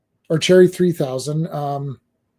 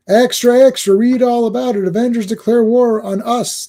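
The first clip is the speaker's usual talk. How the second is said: newsboy call; 6 semitones higher than usual. Acted. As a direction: call it out like a street paperboy. Newsboy crying headline.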